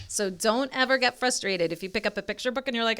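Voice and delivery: ordinary delivery, nothing marked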